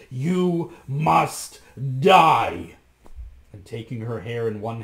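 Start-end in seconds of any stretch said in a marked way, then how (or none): 0.1-2.8 much louder roar; furious cry. A narrator's reading. Bluebeard condemning his wife, roaring. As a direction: roar the sentence. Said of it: "cried Bluebeard".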